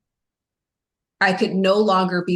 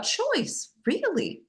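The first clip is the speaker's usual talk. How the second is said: disbelieving; 12 semitones higher, 5 dB quieter, much slower than usual. Retold or imitated. Retold thought, disbelief.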